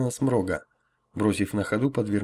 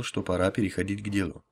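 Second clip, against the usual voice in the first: ordinary delivery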